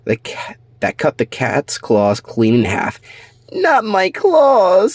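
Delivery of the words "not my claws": high, slow anguished wail; in pain. Acted. King cat losing his claws, slow high wail. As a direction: cry out over the lost claws. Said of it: "said King Cat in pain".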